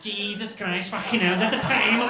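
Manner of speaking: loud, high Liverpool blurt; Scouse morning wife. Retold or imitated. Wife waking late, swearing in a loud Scouse accent. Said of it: "she wakes up in the morning".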